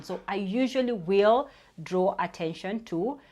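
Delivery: normal talking voice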